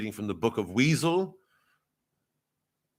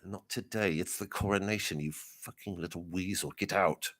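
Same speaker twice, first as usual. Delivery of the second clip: low, much softer cursing rebuff; hushed dismissal; 3 semitones lower, 9 dB quieter than usual. Acted. Church official turning away a mourner, hushed contempt. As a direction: dismiss them quietly under your breath.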